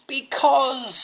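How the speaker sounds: high, loud shrieking princess; mock shriek. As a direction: wail it like an upset princess. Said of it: "she shrieked".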